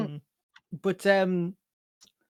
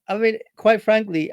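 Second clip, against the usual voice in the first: usual speaking voice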